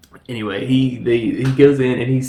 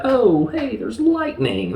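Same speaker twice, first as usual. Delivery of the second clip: mock surprise; 13 semitones higher than usual. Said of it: "They're like".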